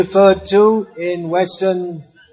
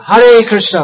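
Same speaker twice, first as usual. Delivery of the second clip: louder call for the crowd; call to repeat; 5 dB louder than usual. Shown as a demonstration. Monk calling again for the crowd to repeat louder. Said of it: "Say please".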